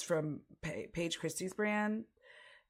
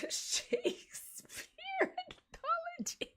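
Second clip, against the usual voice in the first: slow, squeaking high with real laughter; helpless high-pitched giggling speech; laughing through words; slower than usual. Real, not acted. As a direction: lose it mid-sentence, voice shooting up. Cracks up at own joke.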